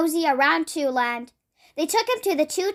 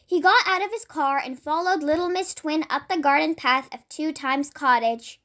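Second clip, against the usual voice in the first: ordinary delivery, nothing marked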